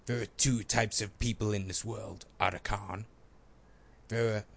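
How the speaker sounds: put-on game-hero voice; measured, in-character soldier delivery; Solid Snake. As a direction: attempt a video game soldier impression. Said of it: "style of Solid Snake from Metal Gear".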